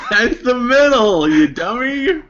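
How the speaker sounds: high, slow laughing jab; laughing tease. Real, not acted. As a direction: tease a friend through laughter. Teasing friend mid-setup.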